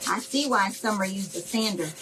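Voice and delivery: ordinary delivery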